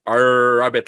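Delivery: put-on "rabbit" in a thick accent, drawn-out R. Learner practising the letter R in class, thick accent. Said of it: "I have a thick accent".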